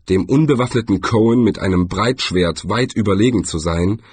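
ordinary delivery

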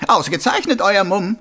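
high, loud mocking voice; a smug killer playing innocent; sneering villain. A narrator's reading. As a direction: Carcer mocking the watchman. Karzer mocks.

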